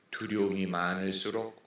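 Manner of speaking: ordinary talk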